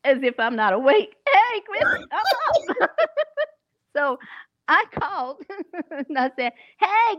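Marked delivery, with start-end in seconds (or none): none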